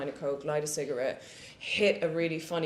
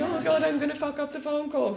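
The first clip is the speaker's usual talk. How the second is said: much higher, put-on panicky voice; mock anxious fretting; 10 semitones higher than usual. Universe mimicking the actress's stage nerves, high mock fretting. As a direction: imitate a worrier fretting, pitched right up. Universe mocking her nervous worrying.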